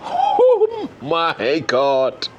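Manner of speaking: very slow, stretched-out gasp of amazement; awestruck. Real, not acted. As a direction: draw out every word in real disbelief. Stunned by the balcony view.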